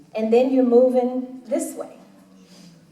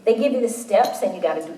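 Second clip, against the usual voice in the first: normal talking voice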